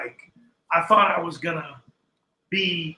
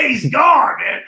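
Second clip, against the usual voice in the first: mock shout; 7 semitones higher, 12 dB louder, slower than usual. Retelling partner vanished.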